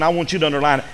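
ordinary talk, nothing marked

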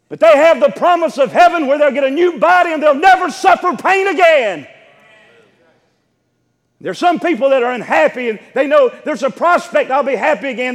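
high, loud, full-force sermon delivery; impassioned preaching. Real, not acted. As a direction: preach it out to the whole congregation, building to a shout.

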